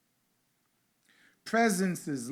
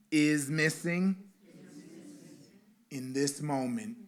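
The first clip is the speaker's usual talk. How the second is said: guided meditation. Acted. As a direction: lead the congregation into stillness gently.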